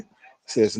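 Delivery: usual speaking voice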